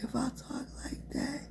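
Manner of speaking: very soft, voiceless whisper; faint whisper. Shown as a demonstration. Showing viewers her strongest voice back then, faint whisper. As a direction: whisper with hardly any breath behind it. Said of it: "that was the strongest".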